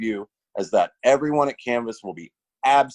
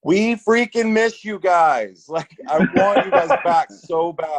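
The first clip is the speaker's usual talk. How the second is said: emotional plea; 6 semitones higher, 5 dB louder than usual. Real, not acted. Emotional plea to missed customers.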